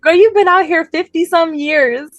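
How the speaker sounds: high, laughing through the words; giggling, high-pitched mock scolding of mom; laughing disbelief. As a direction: crack up while calling mom out. Teasing mom about her accent.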